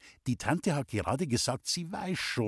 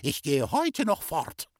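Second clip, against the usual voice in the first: shouted indignation; 8 semitones higher than usual. A narrator's reading. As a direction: announce your departure in a huff. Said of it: "hat die Tante geschrien". (hoch, empört und entschlossen geschimpft)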